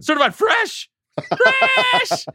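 very high, loud squeal; excited shriek. Acted. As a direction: squeal the word fresh out. Playful shout after a name.

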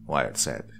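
plain reading voice

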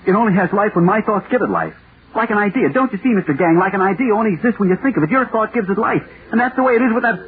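high-pitched, rushing fervour; feverish excitement. Acted. To Mister Gang, explaining the idea in feverish excitement. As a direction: burst out with the discovery, voice climbing high. Man obsessed explains living thought.

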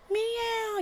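high, small mock meow; a preacher mocking the devil as a house cat; tiny cat meow. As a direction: make the devil a harmless kitten.

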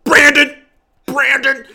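mock yelling a name in disapproval; mock scolding call. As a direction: scold him by name, half joking. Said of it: "over here being like".

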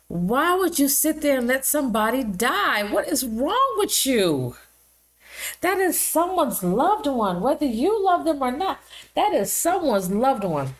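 high, outraged scolding. Real, not acted. Scolding a nurse who let a veteran die, outraged. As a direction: demand an answer, appalled. Angry rant at a negligent caregiver.

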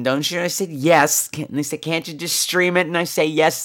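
usual speaking voice

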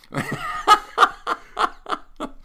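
high, loud burst of laughter; long helpless cackle; big laugh. Real, not acted. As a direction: crack up at your own story. Real laugh at own prank story.

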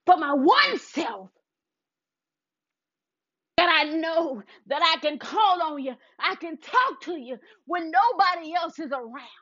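shouted, crying prayer, climbing in pitch; full-force prayer. Acted. Speaker praising God aloud, shouting and crying in prayer.

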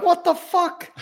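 high-pitched mock disbelief; incredulous. Podcaster imitating Pete watching the show, incredulous. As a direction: imitate a baffled onlooker's outburst.

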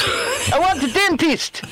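slow, high mock Albanian accent on "I want a dentist!". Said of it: "In an Albanian accent".

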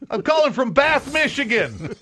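acted small-town caller. Caller from Bath phoning China, mock insistent voice. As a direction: announce the home town proudly down the line.

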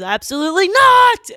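furious booming refusal, high and loud; angry shout. Retold or imitated. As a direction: bellow it like a raging prophet. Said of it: "and he goes".

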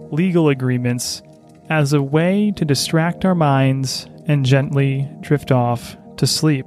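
slow, soothing bedtime host voice; sleep story narrator. Acted. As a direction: lull the listener toward sleep, unhurried. Sleep podcast host intro.

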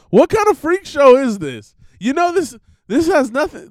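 high, loud disbelieving rant; mock outrage. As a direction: scoff at it like a freak show. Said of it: "I was like".

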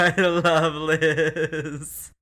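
stretched, slow and loud, all fan glee; gushing excitement. Real, not acted. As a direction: draw it out like a swooning fan. Fan gushing over Liz.

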